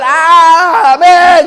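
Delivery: drawn-out, high, loud preacher's amen; shouted amen. Real, not acted. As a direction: build to a rousing shout of praise. (amén gritado, alargado y agudo)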